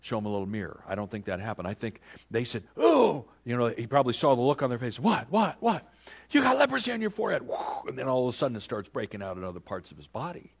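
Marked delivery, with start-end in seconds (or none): 2.8-3.3 high, loud cry of shock; mock horrified "oh". Priests spotting leprosy on the king, mock horror. 5.0-5.9 rapid-fire, higher, louder questioning; mock alarm. King seeing the look on their faces, mock alarm. King puzzled by the priests' looks. 6.3-7.4 high, loud, very fast blurt; acted alarm. Priests telling the king what they see, acted alarm.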